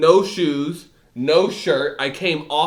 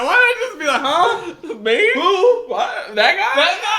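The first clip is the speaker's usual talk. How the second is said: high, laughing mock innocence; giggling imitation of himself feigning confusion; 12 semitones higher, 4 dB louder than usual. Real, not acted. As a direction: play dumb while cracking up. Mocking his own playing dumb.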